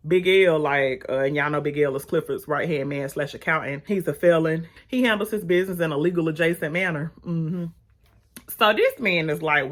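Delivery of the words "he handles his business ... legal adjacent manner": ordinary talk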